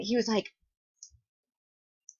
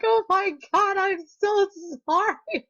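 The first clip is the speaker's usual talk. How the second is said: high, breathless sorry; squeaky retold apology; panicked apology; 7 semitones higher than usual. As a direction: gush out a horrified apology. Apologizing after hitting the guy.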